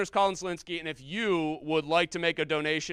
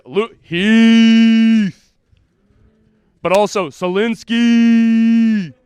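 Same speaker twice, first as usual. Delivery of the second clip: booming drawn-out name calls; stretched, shouted hype names; loud announcer calls; 5 semitones higher, 15 dB louder, much slower than usual. Acted. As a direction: call their names like a ring announcer. Hyping contestants in loud calls.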